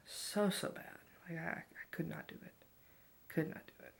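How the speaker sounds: intense breathy whisper. Real, not acted. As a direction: whisper it with urgency. Whispered emphasis about OCD.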